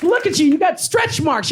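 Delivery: high, jeering imitation; mocked heckler. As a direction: parody a gleeful taunt.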